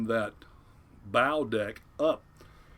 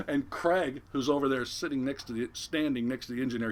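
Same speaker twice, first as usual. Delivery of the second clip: normal talking voice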